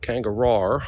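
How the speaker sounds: "Kangaroo" ending in "rar", rising; R-ending pronunciation. Shown as a demonstration. Trying Australian pronunciations of kangaroo.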